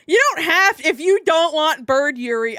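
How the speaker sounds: high, loud outburst; loud protest. Real, not acted. Exclaims at chat after laughing.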